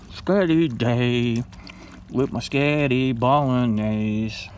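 slow, relishing each syllable of Sketty; slow dreamy food-lover voice; drawn-out savoring. Pretend. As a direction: stretch out Sketty like a treat. Savoring the made-up holiday word.

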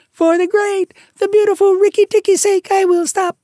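very high, loud chirpy bird voice; a shrill little bird, bursting with triumph; tailorbird. A narrator's reading. Bird tells the mongoose the news, triumphant. Darzee speaking.

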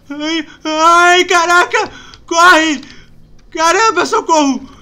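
loud, high frightened yells; panicked yelling. Real, not acted. Scared gamer yelling for help while running.

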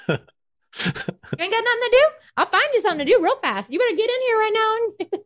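fast, very high scolding mama; high-pitched mock threat to an idle kid; Southern mama. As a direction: order them inside like a Southern mama. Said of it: "Southern Mama".

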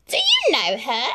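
a catty sister sizing up a rival; Drizella, sneering. Acted. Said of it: "asked Drizella".

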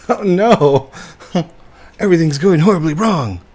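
high, loud playful alarm; excited panic. Real, not acted. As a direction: squeal it like it's all falling apart. Game going wrong, laughing panic.

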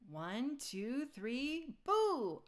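rising count to a loud high boo; spring out and scare him; counting then boo. A narrator's reading. Kids ambushing Dad, loud boo.

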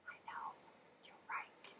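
whispered agreement. Real, not acted. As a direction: breathe it without voice. Whispered aside to class.

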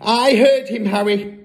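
high, loud frightened insistence; quaking shriek. A narrator's reading. Mother insists to her husband, shrieking. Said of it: "the mother shrieked, still quaking".

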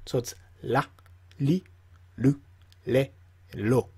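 la li lu le lo with a clear L; L sound. Shown as a demonstration. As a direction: say la, li, lu, le, lo with a plain L, no rolled R. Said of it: "they use the L sound".

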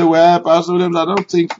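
mock doubting listener; quoting a congregant. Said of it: "You may say".

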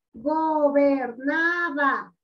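"gobernaba" very slow, split into four syllables. Shown as a demonstration. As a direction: stretch and separate each syllable of "gobernaba". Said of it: "Ese es el silabeo".

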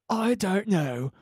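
badly faked British, words stretched out; bad British accent. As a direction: imitate an American failing at British. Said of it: "trying to be British".

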